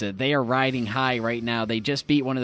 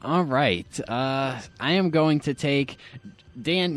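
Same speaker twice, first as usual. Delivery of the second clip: ordinary talk, nothing marked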